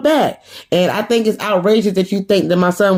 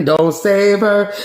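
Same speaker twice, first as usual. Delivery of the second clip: ordinary talk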